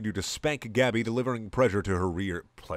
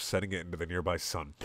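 usual speaking voice

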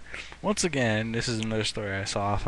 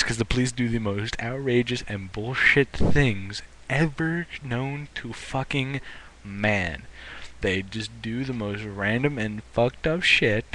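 usual speaking voice

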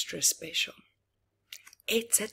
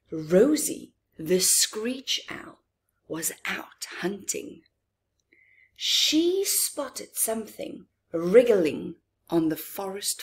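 gentle storybook read-aloud with hushed lines; storyteller voice for little listeners; reading to children. A narrator's reading. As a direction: read it like a bedtime picture book. Reads picture book to children.

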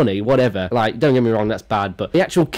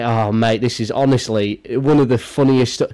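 ordinary talk

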